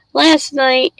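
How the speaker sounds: normal talking voice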